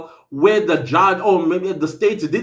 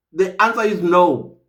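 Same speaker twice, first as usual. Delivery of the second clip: ordinary talk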